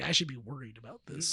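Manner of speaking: low, soft worried mutter; a hint of nervous dad; worried aside. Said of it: "and he's like".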